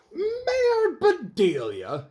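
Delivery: drawn-out high, loud outraged yell. Acted. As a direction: yell it in disbelief, stretched slow. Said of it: "yelled Mayor Thomas".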